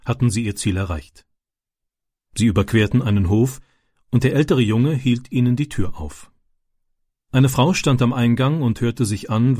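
usual speaking voice

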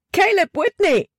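startled, high-pitched cry of the name; panicked cry. A narrator's reading. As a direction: cry out high in sudden fright. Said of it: "schrie Libby auf". (erschrockener, hoher Aufschrei)

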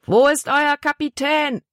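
high-pitched demanding yell, held back in volume; narrated angry shout; bellowing. A narrator's reading. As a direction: roar the question up at the ship. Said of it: "brüllte der große Mann".

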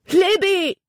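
high-pitched urgent call of a name; distant shout. A narrator's reading. Jordan calls to struggling Libby from far off, shouting. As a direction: cry out her name across the water. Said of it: "vernahm sie den Schrei".

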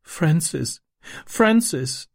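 put-on high, plaintive child's cry of a name; sobbing. A narrator's reading. Little girl clinging to her departing brother, acted high sobbing. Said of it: "schluchzte sie".

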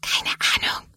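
quick voiceless whisper; hushed answer, all breath; whispering back. A narrator's reading. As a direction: whisper the reply to a friend. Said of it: "Sophia flüstert zurück".

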